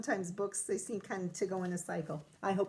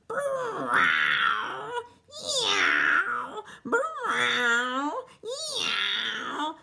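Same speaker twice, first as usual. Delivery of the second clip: high, loud caterwaul yowls; yowling cats; 6 semitones higher, 6 dB louder, much slower than usual. A narrator's reading. Reader yowling the cats' noisy caterwaul. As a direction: wail like fighting tomcats. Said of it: "look at all those funny cat sounds".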